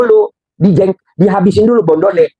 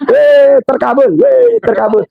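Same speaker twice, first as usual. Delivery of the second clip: high, slow mock cheer; excited whoop; 10 semitones higher, slower than usual. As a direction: whoop like your prayer came true. Dancing on the train, celebrating.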